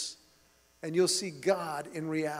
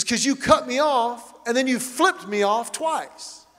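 angry accusation; 5 semitones higher, 6 dB louder than usual. Retold or imitated. Angry driver accuses son.

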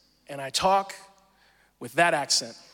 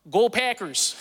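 higher, exaggerated Wisconsin accent; faked Northern accent; 4 semitones higher, slower than usual. Shown as a demonstration. New kid cheering the team to fit in, faked Northern accent. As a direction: lay on thick Northern vowels. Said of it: "I would say".

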